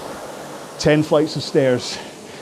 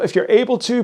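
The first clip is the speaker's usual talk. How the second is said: ordinary talk, nothing marked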